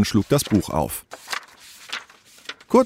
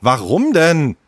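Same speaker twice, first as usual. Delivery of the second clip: higher-pitched, defiant question; angry; 7 semitones higher than usual. Acted. Leo is forbidden to finish the gorilla, angry. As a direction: challenge the ban crossly. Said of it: "sah ihn wütend an".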